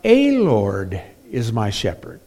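"a" stretched as "ay", then falling; mock of the wrong wording; rejected reading. Shown as a demonstration. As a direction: say "a Lord is my shepherd" as the wrong version.

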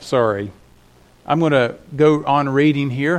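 usual speaking voice

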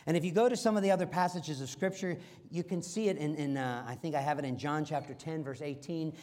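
normal talking voice

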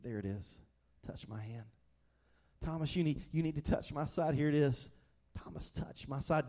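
tender offer to a doubting friend; loving invitation. Retold or imitated. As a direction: coax Thomas in close, tenderly. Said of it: "he comes lovingly and he says".

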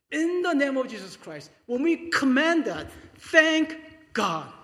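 loud, high proclamation of thanks; commanding declaration. Acted. Pastor showing how to command a situation, loud proclamation.

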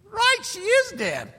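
high, slow mock little-girl wail; hammed-up squeaky girlish voice; little girl's voice. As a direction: give in like a whiny little girl. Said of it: "You like that little girl's voice?".

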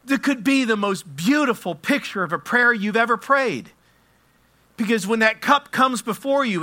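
loud, high-pitched sermon climax; full-force preaching. Real, not acted. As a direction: proclaim it with mounting fervor.

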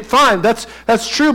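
ordinary talk, nothing marked

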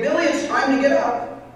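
bright, high, loud wake-up call; cheerful. Acted. Mother calling her son to get up, cheerful and high. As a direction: sing out a cheery morning call to Billy. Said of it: "she says cheerfully".

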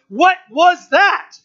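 high, loud, drawn-out astonishment; startled crowd. Acted. Crowd hearing the mighty rushing sound, startled. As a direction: exclaim it slowly, stressing each word.

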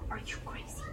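breathy stage whisper of disbelief; hushed are-you-crazy aside. Pretend. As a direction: whisper it like it's absurd. Mock disbelief at grace.

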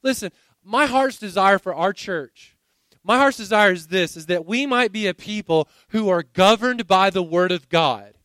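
ordinary talk, nothing marked

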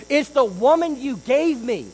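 loud, high protest pointing at the woman; blame-shifting Adam. Retold or imitated. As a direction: shout the excuse, blaming her. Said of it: "He goes".

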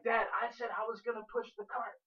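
retold daughter's yelling. Daughter protesting to dad about the cart, mock yelling. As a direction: imitate a child protesting to dad. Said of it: "she was just yelling".

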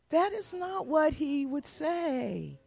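slow, deliberate, each word landing; firm denial. Real, not acted. Pastor's wife insisting to congregation, slow and firm. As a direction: set them straight, slowly.

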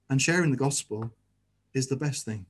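ordinary talk

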